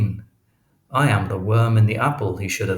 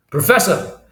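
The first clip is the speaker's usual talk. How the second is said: loud, high shout after someone; raised, high-pitched holler; calling out; 9 semitones higher, 8 dB louder than usual. A narrator's reading. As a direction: call urgently down a corridor. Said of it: "he calls".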